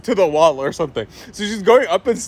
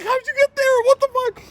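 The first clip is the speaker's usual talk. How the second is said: high panicked mom. Retold or imitated.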